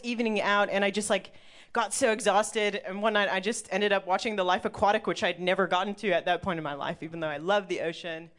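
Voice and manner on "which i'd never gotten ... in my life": ordinary delivery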